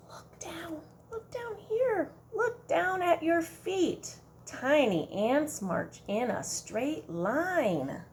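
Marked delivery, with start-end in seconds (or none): 0.1-4.1 excited. Acted. Pointing to ants.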